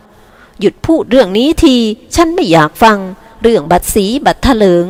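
put-on slow, deliberate old lady shutting it down; stern slow refusal. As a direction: drag out each word to end the talk. Grandma ends the topic.

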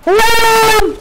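sudden loud high yell; shout. Real, not acted. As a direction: bark it out in shock. Sudden battle moment in game.

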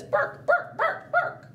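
high, yappy barking dog voice. Retold or imitated. Storyteller voicing the dog for listening children, high yappy barks. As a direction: bark it out like a little dog. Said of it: "The dog says".

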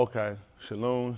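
normal talking voice

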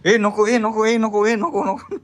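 high, squealing "no, no" in a put-on childish voice; playful high-pitched pleading; mock protest.

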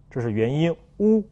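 usual speaking voice

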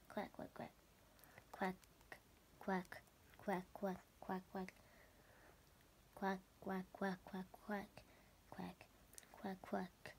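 low, very soft quacks; whispered quacking. Acted. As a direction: make tiny duck noises under breath. Duck slippers quacking.

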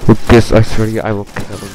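loud furious threat; angry yell. Real, not acted.

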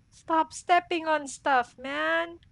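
loud fed-up whine; exasperated yell. Real, not acted. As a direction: beg it to stop. Scolds own character.

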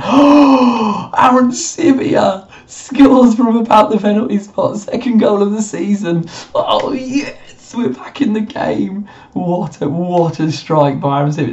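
high, loud, drawn-out cry of joy; excited goal call. Real, not acted. Manager celebrating his side's goal, ecstatic shout. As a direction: let the goal erupt out of you.

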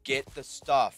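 loud, punchy mock command; imitated eager type. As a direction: bark it like a gung-ho gamer. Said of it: "guys that's just like".

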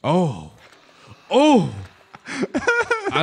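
high, loud startled oh; startled cry. Real, not acted. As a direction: jump in fright. Startled by monster.

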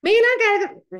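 sudden high, loud mock yell; deafening sales pitch, imitated; shouting demo. Shown as a demonstration. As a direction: blurt it out far too loudly. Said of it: "Cuando hablas gritado".

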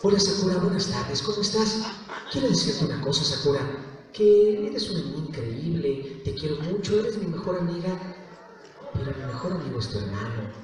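softly spoken, affectionate character voice; soft delivery, dropping at the end; Yukito. Shown as a demonstration. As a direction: tell a dear friend gently. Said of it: "quieren que les haga la voz".

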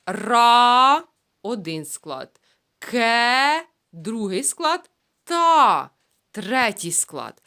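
slow syllable split of ракета. Shown as a demonstration. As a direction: count out the syllables one by one. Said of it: "counting syllables".

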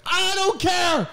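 slow, high shouted refusal; yelling. Pretend. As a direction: drag it out and yell it. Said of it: "I'm like".

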